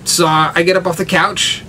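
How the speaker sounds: ordinary talk, nothing marked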